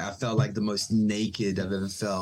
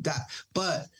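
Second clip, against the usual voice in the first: ordinary delivery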